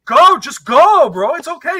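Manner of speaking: high, loud imitation of an insistent wife; mock urging shout. Wife pushing her husband out to the game, mock insistent shout.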